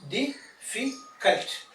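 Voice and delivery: difficult said slowly as di, fi, cult; difficult, syllable by syllable, drawn out slowly; split into syllables. Shown as a demonstration. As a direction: break difficult into three parts with clear pauses. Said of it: "natural divisions of sounds are occurring".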